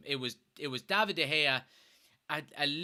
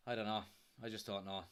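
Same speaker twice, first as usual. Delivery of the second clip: softer and lower, giving up; despairing; 7 semitones lower, 11 dB quieter, faster than usual. Real, not acted. Fan telling viewers he has no answers, quietly despairing. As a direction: trail off in hopeless resignation.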